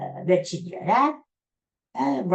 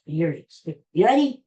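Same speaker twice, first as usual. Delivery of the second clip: normal talking voice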